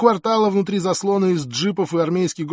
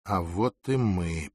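low, soft, slow aside; inner thought; 7 semitones lower, 5 dB quieter, slower than usual. A narrator's reading. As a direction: think it to yourself, slowly. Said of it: "подумал я". (тихо, про себя)

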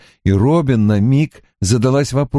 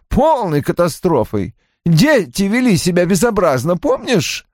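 high-pitched, complaining character voice; peevish man recalling a ruined day; querulous husband; 9 semitones higher than usual. A narrator's reading. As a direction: pitch it up and grumble about the disaster. Narrator voices Roy complaining.

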